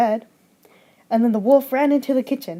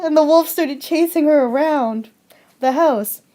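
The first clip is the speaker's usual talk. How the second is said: ordinary delivery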